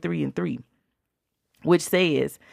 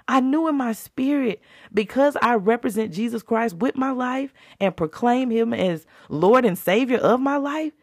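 preaching at full force; 7 semitones higher than usual. Acted. Testifying to listeners, preaching at full force. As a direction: testify it up to the peak.

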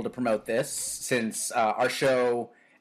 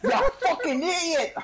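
high laughing Scottish insult; Scottish accent. Pretend. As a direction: scold in a squeaky Scottish brogue. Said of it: "Scottish brogue GPS".

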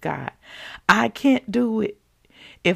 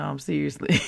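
ordinary talk

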